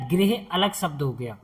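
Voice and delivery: ordinary talk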